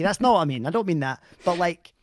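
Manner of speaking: ordinary delivery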